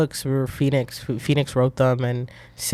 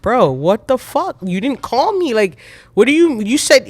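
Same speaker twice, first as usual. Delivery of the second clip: high-pitched indignant complaint, retold; indignant protest; 8 semitones higher, 3 dB louder than usual. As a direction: confront a friend who left you out. Confronts friend for not calling.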